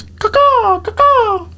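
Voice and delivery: high, slow caw-caw bird call; crow caw. Pretend. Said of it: "that's terrible".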